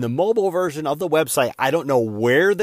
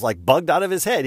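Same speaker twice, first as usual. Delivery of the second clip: normal talking voice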